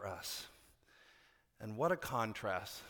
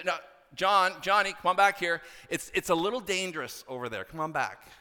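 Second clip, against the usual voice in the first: raised, higher voice calling across a field; calling a child back; 6 semitones higher, 7 dB louder than usual. Retold or imitated. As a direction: call your kid back from danger, firm but worried.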